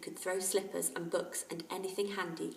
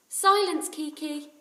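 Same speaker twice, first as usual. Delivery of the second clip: put-on high, loud young boy; boy's voice; 9 semitones higher, 11 dB louder, slower than usual. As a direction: hush the parrot firmly. Jack voiced in story.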